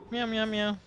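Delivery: high playful meows for the cat; voicing the pet cat chiming into the cast; cat meowing. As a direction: meow like the cat is joining in. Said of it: "My cat's casting the game with us".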